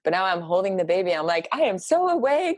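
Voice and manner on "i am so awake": high, keyed-up amazement; wide-awake exclamation. Retold or imitated. New mother holding the baby instead of sleeping, high and wired. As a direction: pitch it way up, buzzing and wired.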